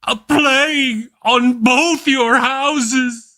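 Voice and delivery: high, slow, grand stage cry on "A plague on both your houses!"; Shakespearean declamation. Acted. Host quoting Shakespeare to viewers, slow grand stage declamation. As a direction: curse it like a dying tragic actor. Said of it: "Avoid bringing a plague on both your houses".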